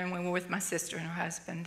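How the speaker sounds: ordinary delivery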